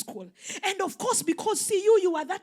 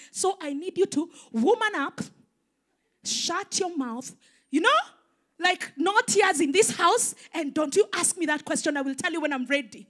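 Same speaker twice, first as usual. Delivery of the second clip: a raised, higher stern mother voice; mother scolding her daughter to stop crying; 7 semitones higher, 7 dB louder than usual. Retold or imitated. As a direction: lay down the law as mom.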